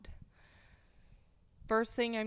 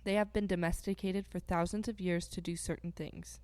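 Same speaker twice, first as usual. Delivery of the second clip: ordinary talk, nothing marked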